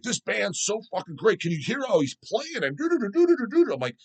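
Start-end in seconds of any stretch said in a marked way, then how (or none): 0.0-2.6 imitating gushing fans. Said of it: "They're like". 2.6-3.7 much higher vocal riff noises; mimicking guitar riff. Death metal fans praising a riff, sung as high scat.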